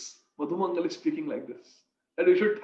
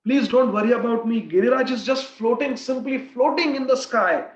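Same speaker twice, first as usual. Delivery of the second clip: put-on playful child Krishna, higher and louder; 3 semitones higher, 6 dB louder than usual. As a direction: reassure Maiya, carefree and playful. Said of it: "He says".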